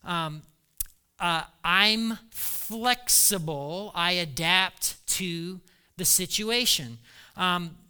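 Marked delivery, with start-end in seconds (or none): 1.6-7.0 very slow, measured I'm flexible; unhurried Paul, deliberate; quoting Paul. Retold or imitated. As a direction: spell it out slowly for Paul. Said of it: "what he's saying is".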